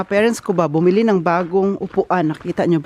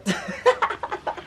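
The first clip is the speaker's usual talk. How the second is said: high, soft giggle; quiet high-pitched laughter; giggling; 9 semitones higher, 7 dB quieter than usual. Real, not acted. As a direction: burst into a high little giggle. Laughs at trying snow challenge.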